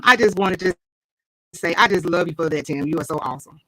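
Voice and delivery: ordinary talk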